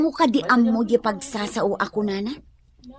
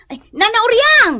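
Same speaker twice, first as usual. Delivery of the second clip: loud, higher cry of alarm; alarmed call; 9 semitones higher, 7 dB louder than usual. Acted. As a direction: shout her name in sudden worry. Alarmed on finding her sick. (napigsa nga ikkis ti pannakaklaat)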